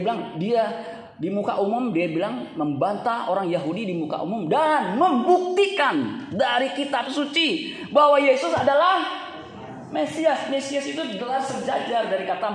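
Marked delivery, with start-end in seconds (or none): 2.5-10.5 forceful preaching. Real, not acted. Preacher declares that Scripture proves the Messiah, forceful preaching. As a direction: build to a loud, high proclamation.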